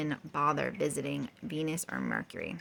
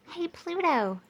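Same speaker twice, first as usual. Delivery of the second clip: shouted call; 11 semitones higher than usual. A narrator's reading. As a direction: call out high across space. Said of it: "shouted Speedy the space rocket".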